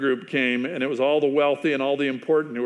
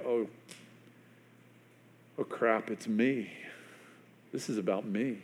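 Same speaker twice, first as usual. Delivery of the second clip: much softer, low dismay; hushed realization; 6 semitones lower, 12 dB quieter than usual. Retold or imitated. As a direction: quietly admit it is you. Imagined confession, hushed.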